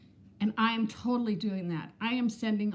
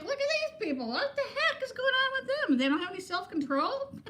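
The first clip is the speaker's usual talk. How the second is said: much higher, laughing scornful mock voice; smug couch critic laughing at the people on TV; mocking judgmental self; 12 semitones higher than usual. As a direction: sneer at them with a laugh, pitched way up. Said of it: "I could be like".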